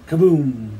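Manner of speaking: loud imitated blast; booming falling kaboom; storyteller's sudden loud boom; bomb explosion. A narrator's reading.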